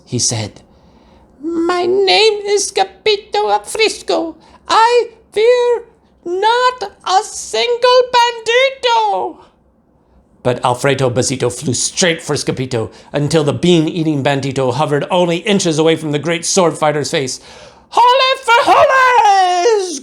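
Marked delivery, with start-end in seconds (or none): 1.4-9.5 high, slow small dog voice; soft brave pup. A narrator's reading. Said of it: "in his muy, muy soft voice". 17.9-20.0 high, loud, slow shout; brave cry. A narrator's reading. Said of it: "cried Scapito".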